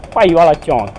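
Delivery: Punjabi accent, Paiwala with a plain unaspirated p. Shown as a demonstration. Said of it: "call it in the Punjabi accent".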